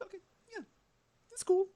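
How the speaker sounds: tiny high falsetto; small, squeaky polite approval; mock lukewarm. Streamer voices reaction to the weaker songs, mock lukewarm falsetto.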